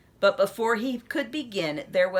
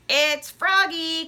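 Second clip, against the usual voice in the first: croaky frog; 7 semitones higher, 5 dB louder, slower than usual. A narrator's reading. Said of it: "croaked the familiar voice".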